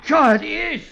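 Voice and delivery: rising, eager and breathless over the gold; greedy excitement. Acted. Keatman gloating over the gold bricks, rising excitement.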